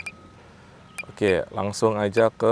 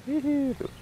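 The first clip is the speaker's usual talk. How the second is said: giggling; 4 dB quieter, slower than usual. Real, not acted. Giggling at the flight.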